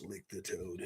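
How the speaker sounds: low, soft muttered read of the phrase. Acted. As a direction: mumble it to yourself first. Reads chat's phrase first.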